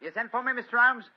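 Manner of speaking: Holmes lightly putting on a working man's voice; detective in disguise as a gasfitter, lightly voiced; disguised British workman. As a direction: play a humble tradesman at the door, a touch rough. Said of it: "a typical example of the British working man".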